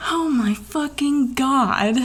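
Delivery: slow, high, drawn-out exasperation; exasperated groan. Real, not acted. Hearing the tow truck takes an hour, exasperated.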